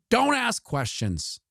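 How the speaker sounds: slow, squeaky high warning; high mock voice.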